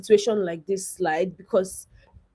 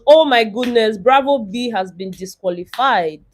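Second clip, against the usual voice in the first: ordinary talk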